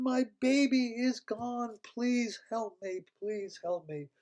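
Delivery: high, slow pleading; pleading queen. Acted. Dragon queen begging the puppies for help, high slow plea. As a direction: beg for help as the voice sinks.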